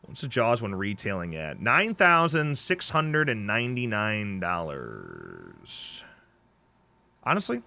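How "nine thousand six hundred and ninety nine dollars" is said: very slow, stretched reading of the figure; drawn-out price reveal. Real, not acted. Host reading the Jaws machine's price, slowly drawn out. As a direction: drag out every number to the last word.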